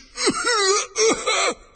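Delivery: high, hoarse choking splutter; loud strangled splutter; choking horse noise. A narrator's reading. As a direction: choke like a whinnying horse. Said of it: "there it choked".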